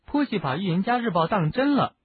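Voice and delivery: high, cutting remark; tart. A narrator's reading. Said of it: "赫敏尖刻地说".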